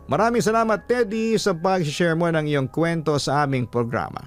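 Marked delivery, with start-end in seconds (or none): none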